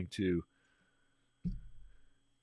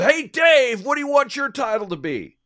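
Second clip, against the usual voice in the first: very high, very loud mocking call to a coworker; a high, loud hammed-up yell at Dave; yelling across the floor; 11 dB louder than usual. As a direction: holler it across a big open office to needle him. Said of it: "she yells over the sales floor".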